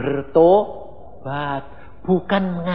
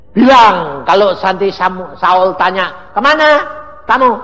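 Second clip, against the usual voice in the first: God instructing; 2 semitones higher, 10 dB louder than usual. Acted. God tells Samuel what to answer Saul, loud and commanding. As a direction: lay out the plan with authority.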